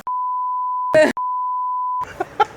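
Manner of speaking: long, very high cackle; shrieking laugh. Real, not acted. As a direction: burst into a squealing laugh.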